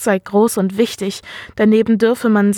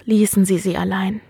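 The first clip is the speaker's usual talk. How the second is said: plain reading voice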